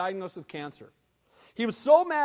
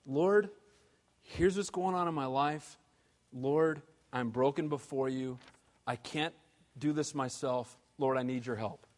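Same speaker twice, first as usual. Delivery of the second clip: softer, lower, pleading to the Lord; humble prayer; 8 semitones lower, 5 dB quieter than usual. Shown as a demonstration. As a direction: pray it quietly, broken and sincere. Model prayer of brokenness.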